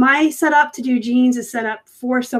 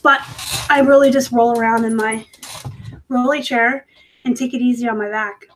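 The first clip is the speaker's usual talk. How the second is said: normal talking voice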